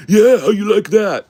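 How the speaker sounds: high, loud mocking gloat; mock hillbilly gloat. Hillbilly gloating after muting him, loud mock squeal. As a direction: crow it like a smug hillbilly.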